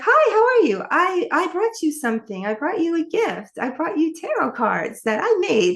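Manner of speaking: high, sugary imitation of an overly friendly woman; imitating the new age woman. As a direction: put on a sweet high voice to mimic her eager gift-giving. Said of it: "so she's like".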